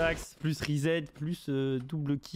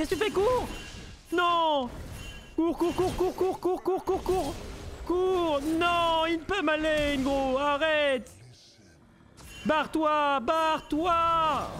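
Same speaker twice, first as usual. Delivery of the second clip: panicked shouting; 12 semitones higher, slower than usual. Real, not acted. Streamer yelling at a teammate to flee, frantic and much higher. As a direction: scream at your teammate to run for his life. Panicked ally fleeing in game.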